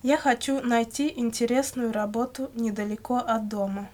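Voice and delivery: normal talking voice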